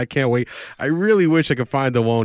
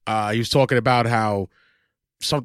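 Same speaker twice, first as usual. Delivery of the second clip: ordinary talk, nothing marked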